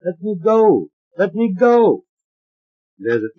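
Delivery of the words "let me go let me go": high, loud pleading cry to be freed; a trapped inner voice crying out in fear; tiny frightened cry. A narrator's reading. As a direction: beg to get out, high and scared. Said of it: "The voice cries".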